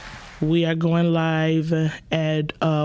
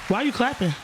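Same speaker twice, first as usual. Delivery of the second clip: higher, rising disbelief at the clapping; incredulous; 7 semitones higher than usual. Real, not acted. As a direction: ask it like you can't believe she's cheering. Confused by the clapping co-host.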